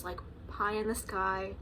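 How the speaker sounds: ordinary talk, nothing marked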